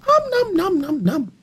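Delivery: nom nom munching. Pretend. Monster will eat your face.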